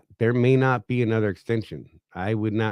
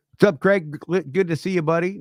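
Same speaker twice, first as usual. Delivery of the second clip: excited greeting; 8 semitones higher, 4 dB louder than usual. Real, not acted. Greeting a viewer in chat.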